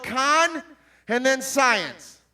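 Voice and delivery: conscience slowly split as con, then science; syllables split apart. Shown as a demonstration. Preacher spelling conscience slowly for kids. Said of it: "listen to how conscience is spelled".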